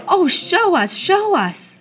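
high, loud begging; a loud, high excited plea; eager cry. Acted. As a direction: plead excitedly to see it. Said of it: "Denise and I both cried".

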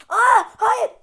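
shrieks high and very loud as a zombie lunges; a real startled yell of no; panicked scream. Real, not acted. Zombie jumps on him.

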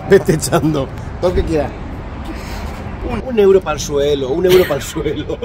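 playful calling out. Pretend. Asking jokingly for a euro for the floor, playful call.

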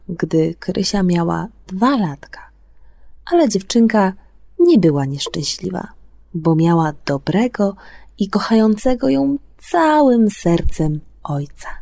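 ordinary delivery, nothing marked

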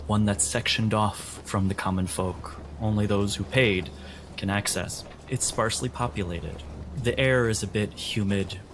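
ordinary talk

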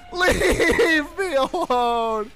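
laughing mock wail. Repeating line, laughing.

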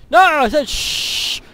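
mock scolding. Shushing the noisy game voice with a loud scold. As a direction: snap at them to hush.

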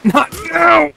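high, loud furious outburst; angry yell. Real, not acted. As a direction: scream it at the screen in real rage. Horse killed in game, yelling.

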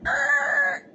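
sudden high, loud growling yell; imitated roar. Reenacting the stalker's car rushing at her, loud mock roar. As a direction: blast it like a car gunning at you.

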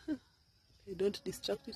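soft and slow so as not to disturb; quiet, careful voice; hushed. Real, not acted. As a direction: keep it hushed near the bee. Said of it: "I hope I'm not too loud".

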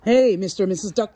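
ordinary talk, nothing marked

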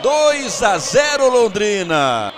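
long, slow, roaring goal cry; goal call. Real, not acted. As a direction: stretch the goal shout out. Second goal, long shouted call.

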